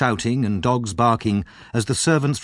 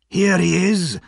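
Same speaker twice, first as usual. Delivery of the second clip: higher, louder excited cry; 8 semitones higher, 4 dB louder, slower than usual. A narrator's reading. Servant finding the boy on the step, excited cry. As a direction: crow it out, thrilled. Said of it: "he cried excitedly".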